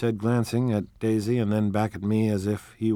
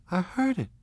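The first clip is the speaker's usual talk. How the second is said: complaining; 10 semitones higher than usual. Acted. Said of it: "she complained".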